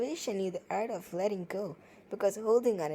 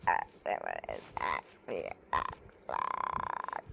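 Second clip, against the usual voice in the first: deep, flat monotone robot; attempted robot voice, much lower; robotic voice; 7 dB quieter than usual. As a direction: read it like a machine, very low. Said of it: "I'm going to read it in robotic voice".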